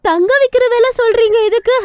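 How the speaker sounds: shocked haggler. Acted. Stingy woman balks at the vendor's price, outraged. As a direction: balk at the price.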